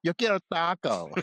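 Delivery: put-on the baby demands tacos; angry baby tirade. Sketch baby demands tacos, mock angry. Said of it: "a tirade of how angry the baby is".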